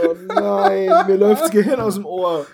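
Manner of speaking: laughing through the words; groaning, helpless laughter. Real, not acted. Groaning at bad pun.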